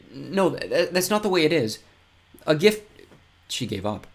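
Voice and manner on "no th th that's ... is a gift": exasperated. Acted. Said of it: "sighed in exasperation".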